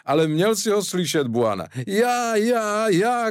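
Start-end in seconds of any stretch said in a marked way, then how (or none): 1.9-3.3 crying out. A narrator's reading. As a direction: cry out as the plaster pulls the hairs. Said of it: "křičel".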